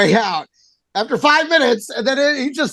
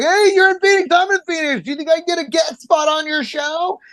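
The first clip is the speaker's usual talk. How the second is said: high, eager pleading voice, pitched up; imitating the comedian; 7 semitones higher than usual. Comedian asking the host for a guest spot, high eager mimicry. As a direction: lightly mimic a comic begging for a spot. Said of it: "He's like".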